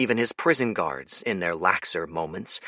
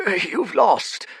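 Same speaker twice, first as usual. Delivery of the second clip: put-on weary old voice; 11 semitones higher, 5 dB louder than usual. Fate tells Jez.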